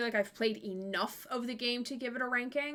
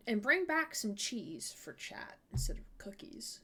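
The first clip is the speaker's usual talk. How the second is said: secretive whisper to chat; 3 semitones lower, 8 dB quieter than usual. Real, not acted.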